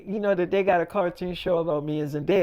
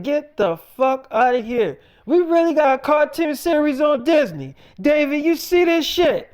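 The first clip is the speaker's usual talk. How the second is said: higher and louder, bursting with disbelief; excited disbelief; 7 semitones higher, 3 dB louder than usual. Retold or imitated. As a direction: yell it out in amazed shock. Friend shocked by cartoon series.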